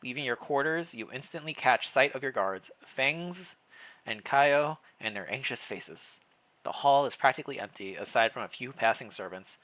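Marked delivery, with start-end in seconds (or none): none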